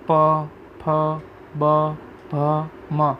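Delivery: પ, ફ, બ, ભ, મ each made with both lips; lip-sound demo. Shown as a demonstration. Teacher showing students lip letters, પ, ફ, બ, ભ, મ sounded separately. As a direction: sound પ, ફ, બ, ભ, મ one at a time. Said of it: "તમે બે હોઠ પકડીને બોલો".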